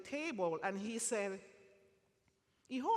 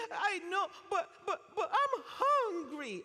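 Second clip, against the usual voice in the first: pleading hungry man; 10 semitones higher, 5 dB louder than usual. Retold or imitated.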